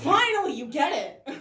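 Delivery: high, loud cry of finally; mock-exasperated, laughing outburst; exasperated relief. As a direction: burst out as if the wait is over. Acting relief at being understood.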